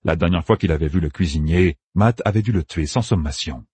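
ordinary delivery, nothing marked

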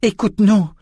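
high-pitched urgent plea; imploring. A narrator's reading. Callan implores the girl while wrestling her, high urgent plea. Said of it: "implora Callan".

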